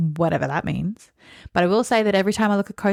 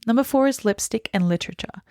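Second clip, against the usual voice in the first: normal talking voice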